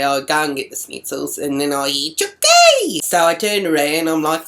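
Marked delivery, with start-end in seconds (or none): 2.1-3.0 high-pitched loud heckle; imitated yell. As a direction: yell it like a taunting boy calling out. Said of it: "three voices yelling out".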